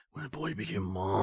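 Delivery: teasing, quiet echo of his reverence; mock hushed. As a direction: softly mimic a solemn line. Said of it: "Did you do it in that way?".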